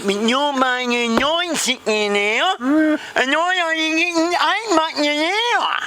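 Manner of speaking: high, slow, garbled grievance; indignant Vicky. Acted. Vicky complains to boss about pay, high garbled outrage. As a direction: stretch the complaint in a mangled put-on voice.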